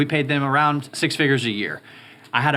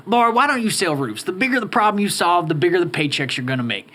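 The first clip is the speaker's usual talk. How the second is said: Skeeter voice; 6 semitones higher than usual. Retold or imitated. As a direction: ham up an old Texas roofer. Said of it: "give us a Skeeter voice".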